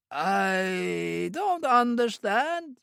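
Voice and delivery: high, slow feigned confusion; puzzled villain. A narrator's reading. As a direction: play dumb, drawn out.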